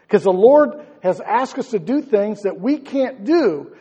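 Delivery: impassioned pastor pouring it out; preaching at full force. Acted. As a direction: preach it hard and high.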